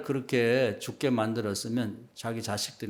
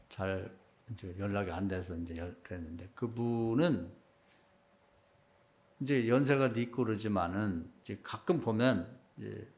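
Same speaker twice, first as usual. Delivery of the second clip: usual speaking voice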